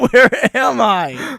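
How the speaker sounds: loud, high, stretched-out disbelief; bewildered mock cry. Storyteller lost among odd strangers, crying out bewildered. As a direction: cry out slowly in comic bewilderment.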